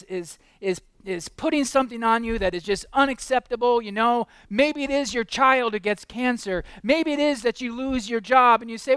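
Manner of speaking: loud, high pulpit intensity; fervent sermon climax; impassioned preaching. Acted. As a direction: drive the point home at full voice. Preaching at full force.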